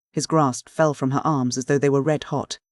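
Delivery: usual speaking voice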